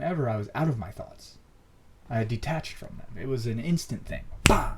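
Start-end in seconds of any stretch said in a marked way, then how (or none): 4.4-4.7 loud, high imitated bang; sudden "bah" burst. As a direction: burst it out like an instant impact. Imitates the instant shift hitting him.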